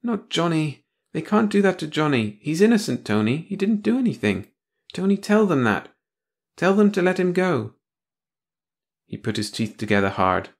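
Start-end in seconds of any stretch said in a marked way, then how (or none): 0.0-7.7 high, desperate pleading, much higher; pleading in fear. Acted. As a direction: beg him to save Johnny. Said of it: "The girl began to tremble all over".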